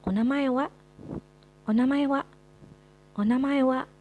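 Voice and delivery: teacher modeling onamae wa; model pronunciation. Shown as a demonstration. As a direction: drill onamae wa as a question. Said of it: "Selanjutnya ada kata".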